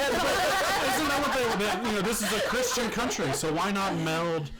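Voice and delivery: cracking up mid-sentence; laughing. Real, not acted. Trolling, cracking up.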